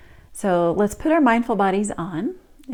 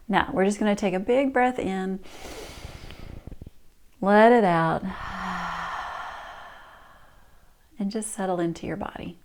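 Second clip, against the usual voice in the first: calm grounding exercise. Shown as a demonstration. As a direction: settle them gently into stillness.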